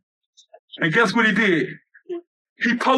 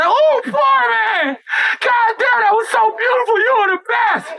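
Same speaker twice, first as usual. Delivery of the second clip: mock ecstatic praise; 11 semitones higher than usual. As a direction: gush like she's just been thrilled. Woman swooning after sex.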